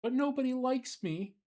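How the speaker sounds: high, tearful little bug voice; Billy crying. Acted. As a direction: whimper it like a sad child. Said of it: "Billy cried".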